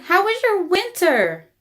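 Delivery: excited. Acted. Sophie greets sprout joyfully.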